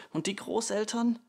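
put-on high-pitched child's cry; calling out. A narrator's reading. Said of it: "lief zur Mutter hinein und rief". (hoher, drängender Ausruf)

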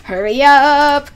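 drawn-out exasperated yell; impatient shout. Real, not acted. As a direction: yell it at the stalling furnace.

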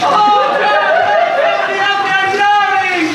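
high, loud desperate shouting; cries for help. Acted. Hammed-up drowner shouting for help from the water, high and loud. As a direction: yell for help like you're going under.

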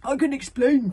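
flustered outburst; stammered excuse. A narrator's reading. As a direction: blurt out an excuse. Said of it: "Chief stammered".

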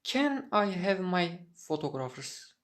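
ordinary talk, nothing marked

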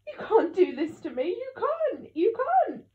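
high, loud wailing protest; distraught, near sobbing. A narrator's reading. Mum begging Dad not to leave, distraught wail. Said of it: "Mum started sobbing".

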